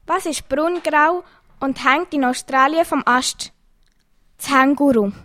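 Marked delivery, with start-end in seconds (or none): none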